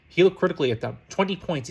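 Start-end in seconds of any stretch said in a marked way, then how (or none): none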